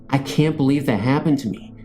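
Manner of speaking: ordinary delivery, nothing marked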